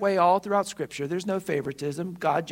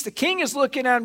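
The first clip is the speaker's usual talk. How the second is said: ordinary talk, nothing marked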